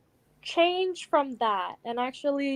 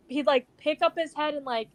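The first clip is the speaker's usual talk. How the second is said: ordinary talk, nothing marked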